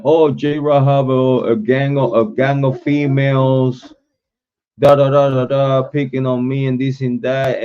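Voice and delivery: lightly voiced mock complaint; a hint of a whiny tattler; mock complainer. Mimicking people who complained about him, lightly whiny. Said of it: "when people were saying".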